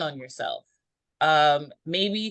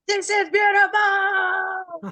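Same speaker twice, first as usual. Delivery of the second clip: high, loud, drawn-out holler; elated mock scream; 14 semitones higher, 5 dB louder, slower than usual. Someone screaming from a mountaintop, elated drawn-out shout. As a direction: shout it out from a mountaintop, stretching the words. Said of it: "and just scream, like".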